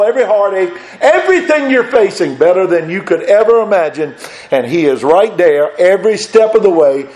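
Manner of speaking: high, loud preacher's climb; preaching at full force. Acted. Preacher promising heaven to the church, at full force. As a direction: lift the congregation with a rising preach.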